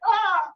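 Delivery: mock high yelp. Imitating the dude staring.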